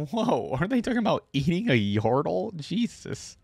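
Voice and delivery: high, loud incredulous whoa; real disbelief at what he just read; shocked surprise. Real, not acted. Reacts to sailors eating yordle.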